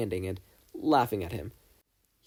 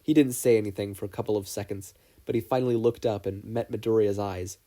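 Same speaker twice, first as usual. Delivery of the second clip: ordinary delivery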